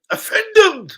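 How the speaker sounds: high, loud scoffing repeat; mock disbelief. Real, not acted. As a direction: scoff at the word. Mocking player's offence.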